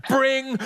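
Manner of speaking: short high "bring" like a doorbell; doorbell imitation. A narrator's reading. Doorbell startles grandson and granny, high short imitated ring.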